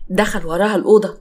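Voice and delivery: plain reading voice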